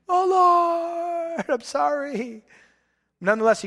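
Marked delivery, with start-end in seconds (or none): 0.1-2.4 much higher, louder desperate plea; crying out to God. Retold or imitated. King crying out to the Lord in battle, pleading. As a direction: cry out for mercy in the heat of battle. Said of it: "Jehoshaphat cried out".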